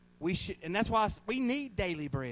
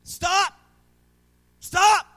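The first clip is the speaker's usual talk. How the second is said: sharp, much higher and louder cry of stop; retold yell; 12 semitones higher, 13 dB louder than usual. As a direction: yell it out to halt the gurney.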